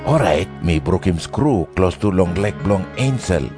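ordinary delivery

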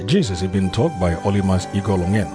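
usual speaking voice

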